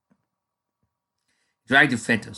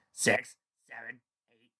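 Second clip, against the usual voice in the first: grumbled; 8 dB quieter than usual. Acted. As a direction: grumble the count under breath. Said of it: "grumbled Glimmer".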